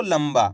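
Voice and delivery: लंबा with a half ma before बा; लंबा as lam-ba, lips closing on m; half ma demo. Shown as a demonstration. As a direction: sound out लंबा with the m clear. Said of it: "कैसे पढ़ा अपन ने इसको".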